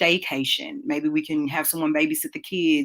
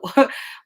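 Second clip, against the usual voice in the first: quick breathy laugh; short laugh; 9 semitones higher, 5 dB louder than usual. Retold or imitated. Mom laughing off the pink hair idea, short laugh. Parent answering a child's wild idea.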